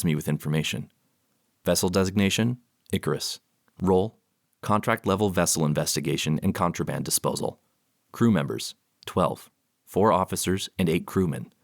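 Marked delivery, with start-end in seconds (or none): none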